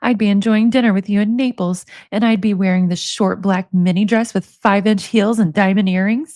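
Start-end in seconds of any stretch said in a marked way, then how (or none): none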